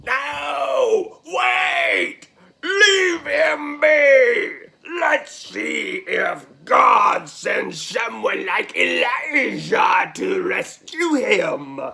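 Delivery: loud drawn-out crowd heckle; yelled jeer. Acted. Onlooker telling the crowd to wait for Elijah, slow yell. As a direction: yell high, then slow into a taunt. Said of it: "yells out".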